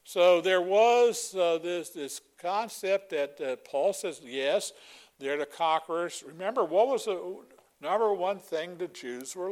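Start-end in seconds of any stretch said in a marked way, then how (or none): none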